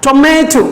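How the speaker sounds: Tomato with the middle syllable stressed and held. Shown as a demonstration. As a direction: lean on the "ma" in Tomato. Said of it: "মাতে জোর দিচ্ছি".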